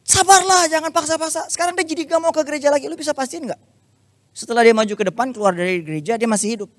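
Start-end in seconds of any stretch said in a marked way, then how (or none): 0.1-3.6 high-pitched mock rant; angry rant. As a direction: rant at him like an indignant friend. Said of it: "Dia marah-marah lagi". (teman marah-marah dengan nada tinggi)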